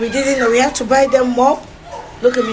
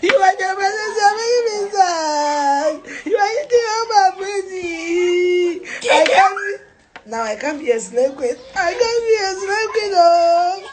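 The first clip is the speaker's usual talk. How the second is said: hammed-up higher wailing, slow; mock crying; 5 semitones higher than usual. Mom fake-wailing to her kids about money, higher mock sobbing. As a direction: wail like a spoiled diva. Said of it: "That she's crying".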